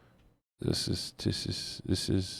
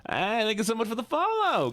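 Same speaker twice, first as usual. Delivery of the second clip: squeaky high thank-you voice; high cutesy voice; 14 semitones higher than usual. Acted. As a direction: chirp it way up high.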